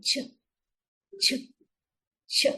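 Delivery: Ch said as a short bouncing burst of sound; phonics sound demo. Shown as a demonstration. Teacher modelling the sound for the children. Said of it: "let out a bouncing sound".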